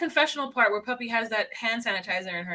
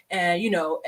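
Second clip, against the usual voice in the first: ordinary talk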